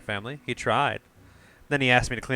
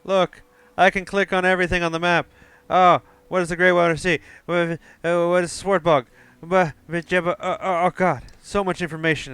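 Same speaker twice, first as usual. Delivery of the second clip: high, loud and overwhelmed; excited; 7 semitones higher, 6 dB louder than usual. Real, not acted. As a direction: babble with delight at the map. Excited by the map.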